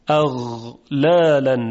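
slow اغلالاً with voiced throaty غ; Arabic ghayn. Shown as a demonstration. Teacher demonstrating a Quranic word for students to copy. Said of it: "شما هم تکرار کنید".

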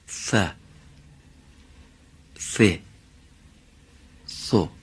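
"ث" with tongue between teeth, drawn out; Arabic tha demo. Shown as a demonstration. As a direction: hiss "ث" through the teeth. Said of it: "حرف ثا رو برای شما تلفظ کنم".

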